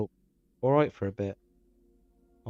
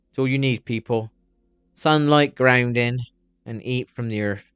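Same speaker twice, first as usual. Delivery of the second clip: usual speaking voice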